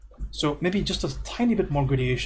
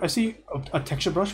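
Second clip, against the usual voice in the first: ordinary talk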